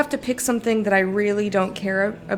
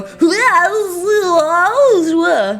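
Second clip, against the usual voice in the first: high, loud garbled wail; loud nonsense mouth noise; 7 semitones higher, 6 dB louder than usual. Shown as a demonstration. Streamer asking chat if they do this, loud gibberish. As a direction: blurt long wordless gibberish at full voice. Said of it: "go live on the internet and go".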